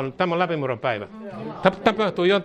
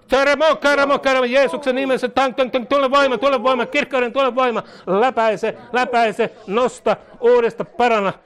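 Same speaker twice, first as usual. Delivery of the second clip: high, driving rhythmic commands; full-force charismatic prayer; 10 semitones higher, 4 dB louder than usual. Real, not acted. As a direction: pound out each prayer command urgently. Prays at full force over someone.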